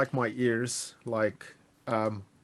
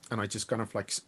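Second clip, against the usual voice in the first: ordinary talk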